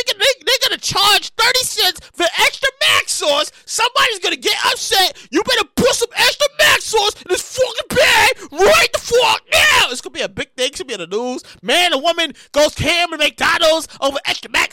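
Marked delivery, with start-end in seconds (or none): 5.3-9.9 furious demand. Pretend. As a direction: bark the demand at the counter. Imagined furious customer.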